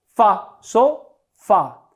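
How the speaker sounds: "Fa, so, fa" en sílabas sueltas con pausas; aísla FA, luego di "so" y deja caer "fa"; sílaba tónica aislada. Shown as a demonstration. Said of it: "¿Cuál es la sílaba tónica en sofá?". ("Fa, so, fa" silabeado, cada sílaba por separado)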